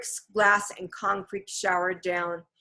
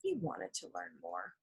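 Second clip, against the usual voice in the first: usual speaking voice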